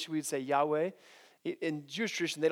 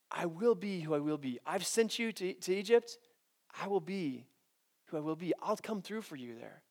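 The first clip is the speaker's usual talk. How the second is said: softer and softer, comforting God; tender reassurance; 8 dB quieter than usual. Acted. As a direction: quietly promise Moses you'll come through. God reassures Moses gently.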